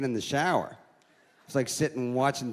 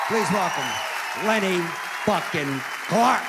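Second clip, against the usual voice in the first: announcing a guest; 5 semitones higher, 3 dB louder than usual. Real, not acted. Host bringing the next comic on, loud intro. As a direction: announce him big to the room.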